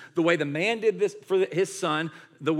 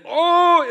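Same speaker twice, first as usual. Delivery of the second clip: loud high acted yell of agony; sudden drawn-out howl as pain returns; hammed-up pain scream; 14 semitones higher, 12 dB louder than usual. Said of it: "I'd be screaming and crying".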